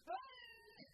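very high, drawn-out mock sob; hammed-up crybaby wail. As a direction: whimper it out like someone still weeping. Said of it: "si me quedo llorando, como hacen algunos".

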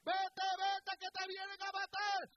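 high, loud, slow shouted proclamation; preacher shouting defiance at the enemy; defiant preaching shout. Acted. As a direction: hurl every hoy out like a challenge. Preacher voices defiant prophetic words.

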